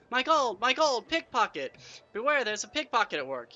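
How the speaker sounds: high, loud cry of a robbery victim; alarmed cry. A narrator's reading. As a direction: cry out to warn the whole crowd. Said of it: "a cry goes up from somewhere in the audience".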